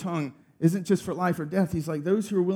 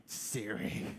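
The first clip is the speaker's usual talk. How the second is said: much softer, lower, weary; exasperated mutter; 8 semitones lower, 14 dB quieter than usual. Retold or imitated. Dad defeated by Siri, a soft lower weary mutter. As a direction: sigh the name out in defeat.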